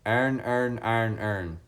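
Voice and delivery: "Iron, earn, iron, earn" run into near-identical "ern" sounds; own accented pronunciation. Shown as a demonstration. Streamer showing how they would pronounce it, accented tongue-twister. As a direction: squash each word of "Iron, earn" toward "ern". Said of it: "that's how I would pronounce that".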